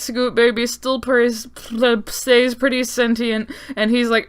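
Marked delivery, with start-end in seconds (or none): none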